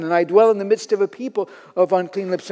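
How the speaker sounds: normal talking voice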